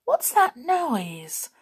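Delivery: high, drawn-out startled question; sudden alarm. A narrator's reading. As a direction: ask as if a sound just caught you. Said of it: "said Goldilocks suddenly".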